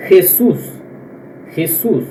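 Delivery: Jesús with a throaty h start; Jesús starting with a rough x sound; Spanish j. Shown as a demonstration. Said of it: "como se fosse o R dobrado". (Jesús com J de R dobrado)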